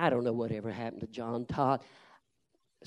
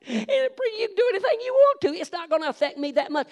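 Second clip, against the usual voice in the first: much higher, louder, fast mocking voice; sneering young man; 11 dB louder, faster than usual. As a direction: brush him off with scorn, quick and high. Mocking the preacher's offer.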